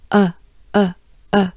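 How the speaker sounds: rounded uh with the lips forward; French uh. Shown as a demonstration. Teacher repeating the French uh sound. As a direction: say uh with the jaw pushed forward. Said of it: "your jaws and your mouth".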